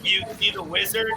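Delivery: ordinary delivery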